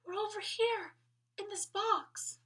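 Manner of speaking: high little voice, slow; a small toy answering from a box; tiny doll voice. A narrator's reading. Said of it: "one of the voices replied".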